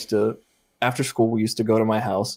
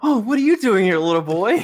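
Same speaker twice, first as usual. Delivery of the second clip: sugary mock politeness; 9 semitones higher, 4 dB louder than usual. Sister greets the ghost boy.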